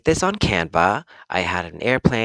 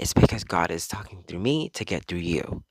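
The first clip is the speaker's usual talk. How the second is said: ordinary delivery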